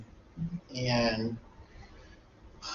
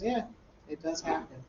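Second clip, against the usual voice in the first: ordinary talk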